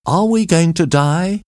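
a reporter cries out in fear; frightened reporter asking if they'll die; frightened cry. A narrator's reading. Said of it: "he cried".

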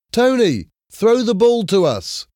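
high-pitched call across a field; shouting. A narrator's reading. Jim calls to Tony for the ball, shouting. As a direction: shout out to get someone's attention. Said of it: "shouted Jim".

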